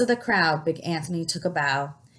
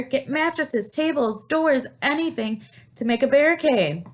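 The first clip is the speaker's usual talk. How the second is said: shouting; 6 semitones higher than usual. A narrator's reading. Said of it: "shouted the mayor".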